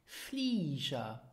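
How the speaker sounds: "Flieger" with zh, falling and drawn out; low FLEE-zher for "Flieger"; correct pronunciation. Shown as a demonstration. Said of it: "it is pronounced Flieger".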